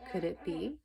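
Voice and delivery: ordinary delivery, nothing marked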